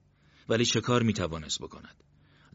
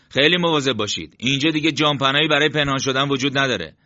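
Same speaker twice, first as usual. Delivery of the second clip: loud, higher voice of warning; urgent caution, pitched up and louder; warning squad leader; 6 semitones higher, 7 dB louder than usual. A narrator's reading. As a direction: warn the men with a raised voice. Leader warns men of exposed ground.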